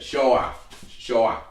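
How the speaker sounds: put-on old Boston SURE with dropped r; affected Boston accent. Shown as a demonstration. Host showing off his best accent, affected Boston. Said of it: "my best old Boston affected accent".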